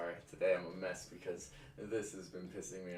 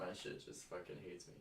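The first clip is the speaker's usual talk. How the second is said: ordinary talk, nothing marked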